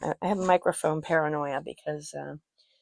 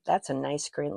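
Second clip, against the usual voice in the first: normal talking voice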